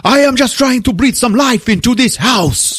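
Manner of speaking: high, hammed-up husband's outcry; impassioned mock outburst. Husband fighting back at wife, melodramatic outburst. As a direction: protest at the top of a high voice.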